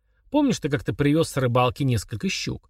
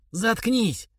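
yelling; 9 semitones higher than usual. Acted. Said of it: "заорал Малышев".

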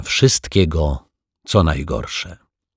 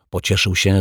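ordinary delivery, nothing marked

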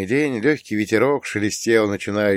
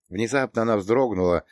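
usual speaking voice

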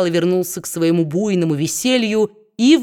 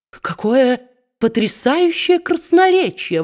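higher-pitched cry of admiration; impressed exclamation; 7 semitones higher than usual. A narrator's reading. Brass responding to Quilp's tirade, exclaiming. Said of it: "воскликнул Брас".

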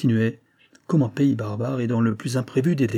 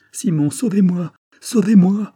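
much higher, loud pleading scream building; rising terrified call; desperate cry for help; 8 semitones higher, 7 dB louder than usual. A narrator's reading. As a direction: scream to him as she is carried off. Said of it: "cria Dolores".

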